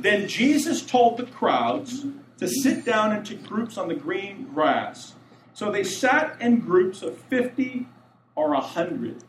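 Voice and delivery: slow, measured verse reading, pitch lifted on openings; scripture reading. A narrator's reading. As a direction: read the verse out deliberately. Reads the verse aloud.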